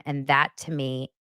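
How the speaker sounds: ordinary talk, nothing marked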